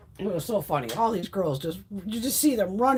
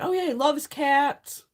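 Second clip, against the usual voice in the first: high, drawn-out breezy assurance; mock reassurance; 7 semitones higher, 3 dB louder, slower than usual. Pound worker handing over a dog, mock reassuring. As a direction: oversell it, stretching the words.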